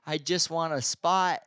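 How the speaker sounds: put-on weepy begging; mock crying. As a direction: imitate someone pleading through tears. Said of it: "she's crying, and she's saying".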